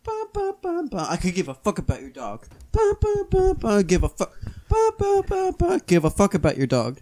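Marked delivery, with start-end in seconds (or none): 0.0-1.1 squeaky high ba ba noises; piping ba ba sounds, very high; high-pitched ba ba. Acted. Mocking noise at dog. 2.7-3.8 squeaky high ba ba noises; piping ba ba sounds, very high; high-pitched ba ba. Acted. Mocking noise at dog. 4.7-5.8 squeaky high ba ba noises; piping ba ba sounds, very high; high-pitched ba ba. Acted. Mocking noise at dog.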